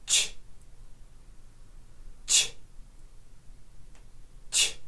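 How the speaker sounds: "Ch" as a short voiceless tch burst; ch sound. Shown as a demonstration. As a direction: say "Ch" alone, a crisp tch, three times. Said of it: "Let's give it a try".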